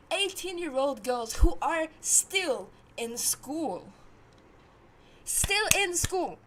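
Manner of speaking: loud, slow, hammered-out outrage; exasperated and forceful; outraged emphasis. Real, not acted. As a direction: drive each word home loudly, slowly. Outraged about uncle dating schoolgirls.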